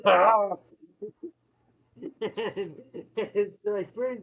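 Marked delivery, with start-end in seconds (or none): 0.1-0.6 loud, high retold shriek; mock demon scream. Possessed mom shrieking at her daughters from the corner.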